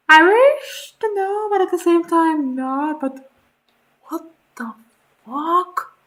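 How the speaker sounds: high, loud torn exclamation; shouting half-amused dread; loud high mock despair; loud horrified reaction. Real, not acted. Listener reacts in horror.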